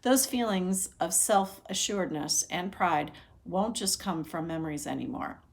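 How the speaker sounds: plain reading voice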